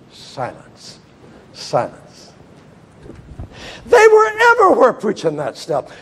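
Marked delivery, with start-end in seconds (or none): 0.1-2.0 unvoiced hush; whispered word. Preacher echoing the secret, whispered. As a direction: breathe it out.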